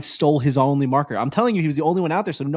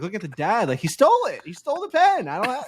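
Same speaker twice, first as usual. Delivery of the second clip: high, whiny mock-crying kid voice; 7 semitones higher than usual. Golfer imitating the kid whining to his dad, high mock crying. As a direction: whine it like a kid near tears. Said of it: "like, crying".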